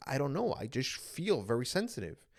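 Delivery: a small, lost answer; quiet tearful reply. Retold or imitated. Crying before family, soft reply.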